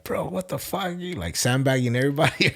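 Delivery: high mock-incredulous bro voice; incredulous buddies. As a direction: play the old training partners, baffled.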